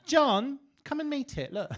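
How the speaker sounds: mock-excited call to a friend; calling over to John.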